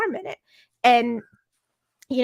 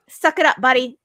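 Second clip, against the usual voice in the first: loud, punchy pep talk, pitched up; retold tough love; 2 semitones higher, 7 dB louder than usual. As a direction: order a friend to toughen up. Acted pep talk to the boy.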